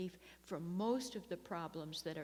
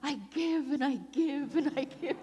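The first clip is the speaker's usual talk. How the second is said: hammed-up self-pity, pitched higher and louder; mock martyr; 8 semitones higher, 5 dB louder than usual. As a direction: play the put-upon martyr for laughs. Mock self-pity, laughing at herself.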